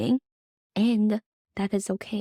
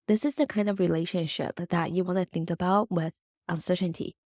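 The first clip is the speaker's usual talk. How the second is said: usual speaking voice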